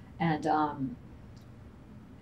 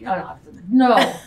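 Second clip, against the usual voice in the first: flustered no; 9 dB louder than usual. Retold or imitated. Embarrassed reply to father.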